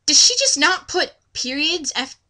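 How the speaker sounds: ordinary talk, nothing marked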